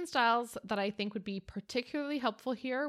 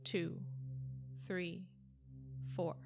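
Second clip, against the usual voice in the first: slow breath counting; slower than usual. Acted. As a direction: count the breaths calmly and slowly. Leads five breaths.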